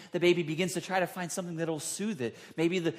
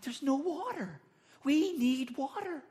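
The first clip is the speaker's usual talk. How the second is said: much higher, pleading cry for water; desperate plea; 10 semitones higher than usual. Retold or imitated. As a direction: sound parched and anxious. People voiced in thirst.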